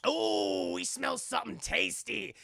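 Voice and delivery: high, drawn-out mock ghoulish relish; hungry killers' voice. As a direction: croon it like monsters scenting prey. Killers scent blood, voiced.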